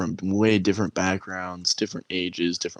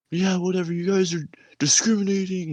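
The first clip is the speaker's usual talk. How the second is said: higher, dismissive mock whine; mouthy recruit; 12 semitones higher than usual. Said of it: "He's like".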